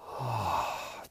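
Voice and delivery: long, soft exhale; relieved sigh. Shown as a demonstration. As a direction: let out a slow sigh of release. Shows the feeling of decompressing.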